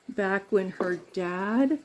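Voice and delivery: ordinary talk, nothing marked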